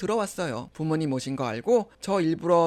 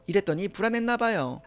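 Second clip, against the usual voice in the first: usual speaking voice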